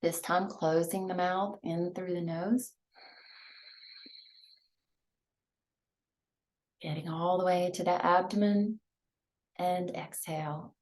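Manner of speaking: guided meditation. Acted. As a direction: ease them into each breath, hushed. Leads breathing meditation.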